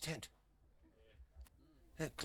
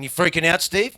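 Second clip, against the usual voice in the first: louder, higher rising question; louder, upturned mocking question; loud mock concern; 4 semitones higher, 10 dB louder than usual. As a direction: call it out teasingly. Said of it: "People going".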